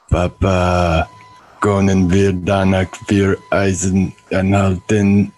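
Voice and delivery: loud, slow clone voice; a clone begging papa; clone child. Acted. Clone asks for ice cream.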